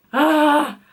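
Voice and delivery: ordinary talk, nothing marked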